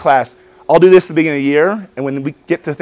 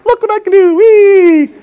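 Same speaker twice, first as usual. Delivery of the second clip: put-on much higher, louder childish squeal; gleeful kid; 7 dB louder, slower than usual. Showing a partner the finger trick, childish glee. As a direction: show off like a delighted little kid.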